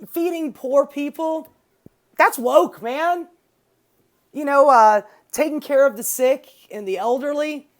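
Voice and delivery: hammed-up anti-woke scoff; mock outraged conservative. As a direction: play a scoffing bro outraged at kindness.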